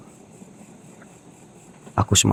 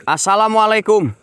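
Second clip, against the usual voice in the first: much higher and louder, a far-carrying call; calling out to a spirit; 13 semitones higher, 3 dB louder than usual. Acted. Ghost hunter calling the spirit to appear, loud high calls. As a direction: hail the unseen woman across the dark.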